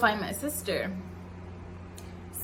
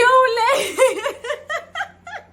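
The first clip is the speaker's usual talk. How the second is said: high, loud happy laughter; delighted laugh; 9 dB louder than usual. Real, not acted. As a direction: laugh at hearing her name shouted. Sister shouting her name.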